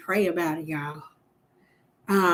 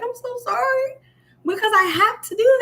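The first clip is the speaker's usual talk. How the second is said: mock sorry; 11 semitones higher than usual. Retold apology after cussing someone out.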